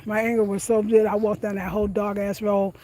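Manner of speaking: usual speaking voice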